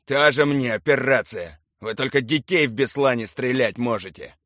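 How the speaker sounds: loud, sneering outburst; scornful, accusing jab; loud contempt. A narrator's reading. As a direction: say it with curled lip, loudly. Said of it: "скривил губы и громко сказал".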